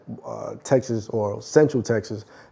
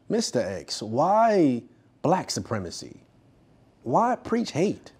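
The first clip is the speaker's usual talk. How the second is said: slow, probing question, lightly acted; challenging interviewer; 2 semitones higher, 6 dB quieter, slower than usual. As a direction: draw out each question to Mister X. Acts interviewer challenging Mister X.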